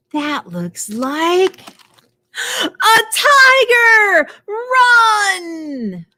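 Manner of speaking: high loud startled cry; hammed-up fright for kids; mock alarm. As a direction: sound the alarm playfully. Story alarm, tiger spotted.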